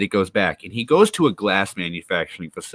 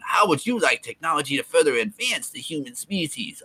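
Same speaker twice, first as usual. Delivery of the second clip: lightly voiced pitchman, higher; mock robot salesman; 6 semitones higher than usual. Streamer pitches technology to viewers as a mock salesman. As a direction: pitch it like a tacky tech ad.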